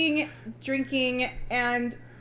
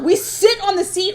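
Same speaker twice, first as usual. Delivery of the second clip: higher, louder pointed complaint; passive aggressive; 6 semitones higher, 4 dB louder than usual. Retold or imitated. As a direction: scold the stranger so they hear. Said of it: "I'm beautifully passive aggressive".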